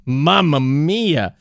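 slow, drawn-out mock Italian accent. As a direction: savour it like a stage Italian. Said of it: "doing accents are fun".

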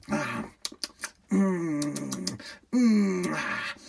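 soft smacking mm and ah sounds; loud eating noises. Shown as a demonstration. Intruder eating the homeowner's food, smacking noises. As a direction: smack and moan over the food. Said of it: "make that noise while I eat".

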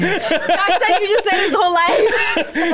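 slow, laughing through the words. Real, not acted. Teasing a friend about his dad, laughing. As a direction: say it while cracking up. Group laughing at shared story.

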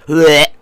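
repeated pretend gagging noise; mimicked retching. Piercer recalling how often they gagged while waiting, mimicked retching. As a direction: gag twice as if about to vomit. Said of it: "all making throw up noises".

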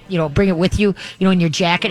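ordinary talk

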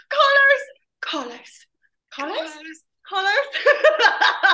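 loud, high shouted Carlos, breaking into laughter; shouting. Shown as a demonstration. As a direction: yell the name in bursts. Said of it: "all the different ways I have to say Carlos".

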